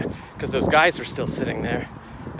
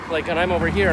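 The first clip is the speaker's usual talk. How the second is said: ordinary talk, nothing marked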